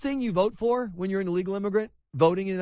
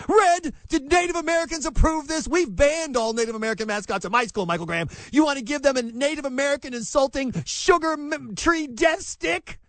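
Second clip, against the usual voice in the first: mock outraged shout; 7 semitones higher than usual. Host acting a hysterical parent over popsicles, hammed-up shouting. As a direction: scream it like a hysterical activist parent.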